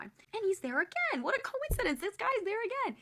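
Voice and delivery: high-pitched sing-song amazement; exaggerated fake astonishment; mock surprise. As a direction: play up the coincidence, very high. Mock surprise at meeting him again.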